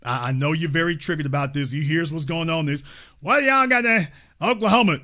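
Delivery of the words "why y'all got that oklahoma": higher put-on voice mocking a caller.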